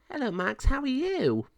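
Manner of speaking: very high, sing-song hello; pound officer greeting a dog. Acted.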